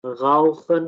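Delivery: गाउखन with a throaty gh at the start, slowly; German R sound. Shown as a demonstration. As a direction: start गाउखन with an Urdu ghain. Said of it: "गा pronounce करेंगे".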